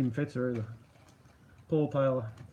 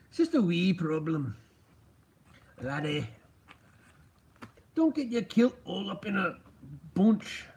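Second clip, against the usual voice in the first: mock Scottish accent. Shown as a demonstration. Streamer showing Robbo an improved try, mock Scottish accent. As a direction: have a rough go at a Scottish accent. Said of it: "that better?".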